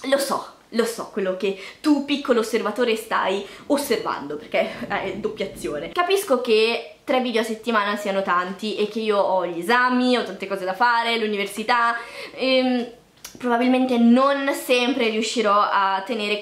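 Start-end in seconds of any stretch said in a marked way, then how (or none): none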